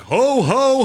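jolly mock Santa laugh. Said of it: "and Santa's like".

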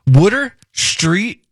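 slow drawn-out Philly wooder; Philly accent. Shown as a demonstration. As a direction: stretch it like a South Philly local. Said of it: "that's how you do it".

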